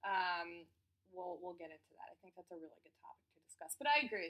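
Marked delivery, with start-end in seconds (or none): 2.1-3.7 whispered aside. Real, not acted.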